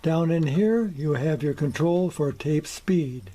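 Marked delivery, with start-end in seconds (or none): none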